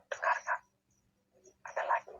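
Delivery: hushed whisper so nothing is disturbed. Real, not acted. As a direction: breathe the words without voice. Whispering during ghost hunt.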